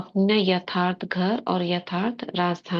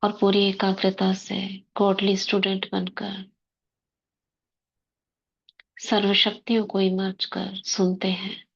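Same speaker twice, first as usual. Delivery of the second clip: guided meditation. Acted. Sister guiding the class into soul-consciousness before murli, meditative.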